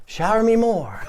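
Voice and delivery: high, breathy-free mock girlish plea; swooning girl. Woman smitten by vomit, mock girlish voice. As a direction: ham up a smitten girl begging. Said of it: "be like".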